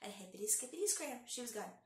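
plain reading voice

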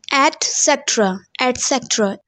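etcetera as et-SET-ra, t sounded; correct pronunciation. Shown as a demonstration. Teacher repeating the fix for students, correctly pronounced. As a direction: say etcetera with t, never k. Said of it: "not ka".

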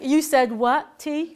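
loud, indignant mock challenge; aggravated customer. Pitta riled by aggravation tea, mock indignant. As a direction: bristle at the tea with a laugh.

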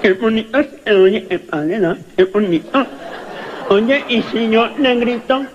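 mangled, nasalised articulation, imitated; mock garbled speech, pitched slightly up; cleft-lip speech. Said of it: "Y el boquinche".

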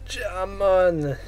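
silly Jamaican accent with a hint of Italian; mock Jamaican. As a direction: clown a Jamaican voice.

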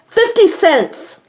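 high, slow, indignant outburst on "Fifty cents!"; riled up. Real, not acted. Griping to viewers about mailing costs, riled and indignant. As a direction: let the price stretch out in outrage. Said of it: "I get a little riled about that".

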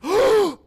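startled gasp. Real, not acted. Jump scare in horror game.

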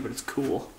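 ordinary talk

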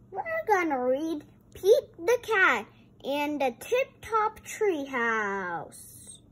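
bright storytime voice; reading to children. Acted. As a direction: read to the kids with big energy. Reading aloud to toys.

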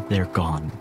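ordinary delivery

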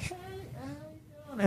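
slipped-up voice. Acted. Host imagining guest messing up her voice, slipped-up voice. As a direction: draw it out softly, pitched well up. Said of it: "do you ever kind of mess up".

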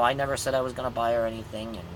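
normal talking voice

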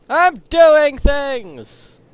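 high, loud, slow boast; mock proud student. Mocking a high schooler who thinks he's busy, loud and slow. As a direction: ham up a proud student's cheer. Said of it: "says me while I do math".

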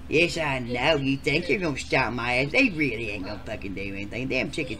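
ordinary delivery

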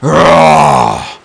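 deep, loud monster groan; monster's bellow. A narrator's reading. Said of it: "bellowed another voice".

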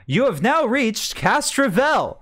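high, loud announcement over the ship's comms; loud megaphone yell. Acted. Ship AI wakes the crew over the comm, megaphone yell. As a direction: blare it like a megaphone wake-up call. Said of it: "loud yelling".

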